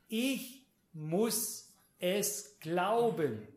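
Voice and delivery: very slow, each word set apart; slow and emphatic. Real, not acted. Preacher tells congregation the catch, slow and emphatic. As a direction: spell out the condition word by word.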